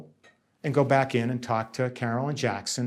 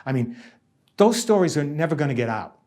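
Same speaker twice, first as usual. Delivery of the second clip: usual speaking voice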